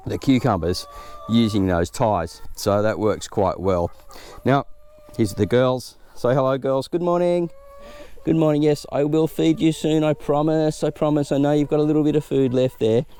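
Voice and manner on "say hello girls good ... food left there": high, sweet voice for the hens; talking to chickens. Real, not acted. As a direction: coo at the chickens like pets.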